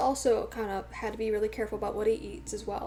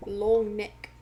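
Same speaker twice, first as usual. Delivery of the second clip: badly faked Australian accent; 4 dB louder, much slower than usual. Streamer playfully trying words out, bad Australian accent. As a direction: attempt a rough, mock Australian accent. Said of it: "That's a really bad Australian accent".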